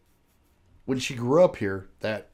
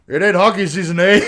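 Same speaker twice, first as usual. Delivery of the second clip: mock Canadian, loud; 4 semitones higher, 10 dB louder than usual. Mocking Canadian hockey man.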